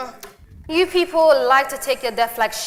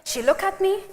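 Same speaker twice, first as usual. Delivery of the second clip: ordinary talk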